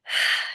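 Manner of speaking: big breathy exhale, let it all go; loud release sigh. Shown as a demonstration. Teacher showing the group how to exhale, loud sigh. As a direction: sigh out the tension loudly. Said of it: "shake it out".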